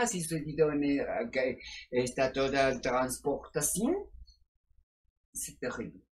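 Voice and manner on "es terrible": ordinary talk, nothing marked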